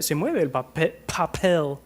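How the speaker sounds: "papel" with breathy puffed p's; aspirated p. Shown as a demonstration. Teacher shows how the puffed "papel" moves the paper. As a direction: push air through the p's of "papel". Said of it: "Y ven que mueve".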